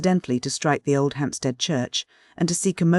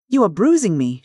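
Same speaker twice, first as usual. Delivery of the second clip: louder, higher cry of pain; 4 semitones higher, 6 dB louder than usual. A narrator's reading. Widow cries out as the suitor shakes her, pained and loud. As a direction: cry out as his grip hurts. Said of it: "she cried".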